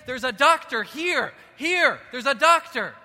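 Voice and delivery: loud, high, slow calling out; urgent shout. Pretend. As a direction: clear the room shouting for the doctor. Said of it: "you're gonna say".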